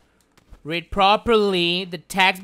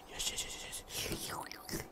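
greedy whisper; 21 dB quieter than usual. Real, not acted. Greedy gloating.

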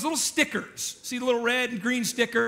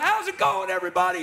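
cheery greeting; 7 semitones higher, 3 dB louder than usual. Retold or imitated. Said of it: "She's like".

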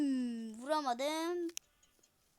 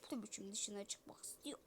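normal talking voice

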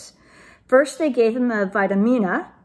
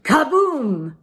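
higher, louder burst; explosive kaboom; 4 semitones higher, 5 dB louder than usual. A narrator's reading. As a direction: boom it out like a cannon. Cannon fires.

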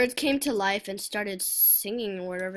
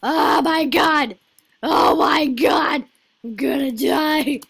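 loud, high screaming panic; drawn-out panicked yell; gamer panic; 5 semitones higher, 13 dB louder, slower than usual. Real, not acted. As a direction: shriek as if about to lose. Panics during the game.

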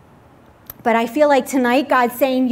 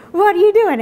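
quick, higher, laughing through it; laughing onlooker; 8 semitones higher, 5 dB louder than usual. Retold or imitated. Preacher mimicking bystanders, fast laughing question. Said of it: "everybody will look at me and be like".

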